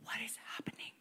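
whispering Habakkuk's question; whispered. Retold or imitated. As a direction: hush it, bewildered. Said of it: "Habakkuk is like".

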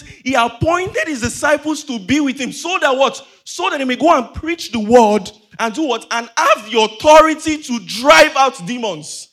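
emphatic, building sermon delivery; forceful preaching. Real, not acted. Preacher recounts Jesus sending out disciples, emphatic preaching. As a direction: press the commission on the congregation.

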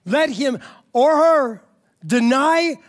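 high, slow, ringing proclamation; full-force preaching. Acted. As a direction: proclaim the verse phrase by phrase, with long pauses.

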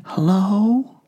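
put-on a little boy answering the phone; small child's voice. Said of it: "a small voice whispered".

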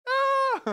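short high yelp of a scared passenger; mock scream of fright. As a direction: squeal like a nervous passenger. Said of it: "You're like".

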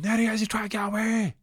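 high, loud panicked shout; panicked high cry. Retold or imitated. Spotting Barry and Sean, yelps in high panic. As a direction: yelp it out in a panic.

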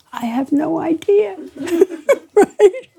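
laughing through the words. Retold or imitated. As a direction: chuckle it out, amused at herself. Tells editor she has no idea.

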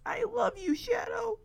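high, soft, choked-up tenderness; fragile, loving plea; tearful affection. Acted. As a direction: say it on the edge of crying. Tearful declaration to Shadow.

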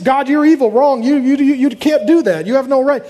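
acted indignant protest; accusing. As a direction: throw accusations at God, stumbling over them. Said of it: "you accuse him".

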